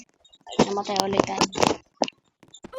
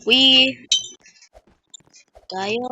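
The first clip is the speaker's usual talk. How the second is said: ordinary delivery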